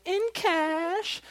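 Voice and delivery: high-pitched, comic afterthought; joking add-on demand. Acted. Creditor finishes his demand for money, comic high voice. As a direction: tack it on up high, not shouted. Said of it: "I'm sure he finished it with".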